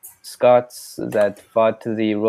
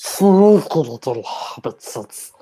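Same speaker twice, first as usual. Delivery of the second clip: Gollum voice. Retold or imitated. Co-host answers with Gollum quote.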